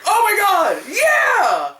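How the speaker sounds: high shouted joy; excited fan cheer. Retold or imitated. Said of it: "we were just like".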